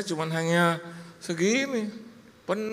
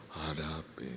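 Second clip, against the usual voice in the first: low, slow, softer pious mother; God's-sibling solemnity; 11 semitones lower, 10 dB quieter, slower than usual. Retold or imitated. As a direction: imitate a mother answering with divine gravity. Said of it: "kayak saudaranya Tuhan gitu loh". (ibu sok ilahi, rendah dan pelan)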